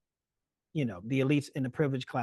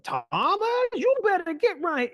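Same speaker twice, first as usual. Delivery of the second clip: much higher and louder scolding mammy voice; Miss Mamie caricature; 5 dB louder than usual. Retold or imitated. Host mocking Disney-fied Tom and Jerry maid scolding Thomas, high mock voice. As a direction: scold like the cartoon housemaid, pitched way up. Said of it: "Miss Mamie".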